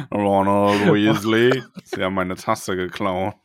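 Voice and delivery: slow, low, drawn-out accusation; a lightly voiced stern teacher, slow and low; Snape impression. Snape gespielt, Tasse geklaut. (langsam und tief, gespielter Snape)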